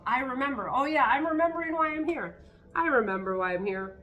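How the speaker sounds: rising into a loud, eager realisation; excited affirmation. Acted. Telling herself she remembers, getting loud and eager. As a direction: announce it like it just clicked. Self-talk affirmation acted out.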